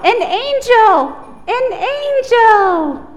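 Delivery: loud, high, slow excited call; thrilled announcement. Acted. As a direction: announce the angel with wonder. Dramatic reveal to children.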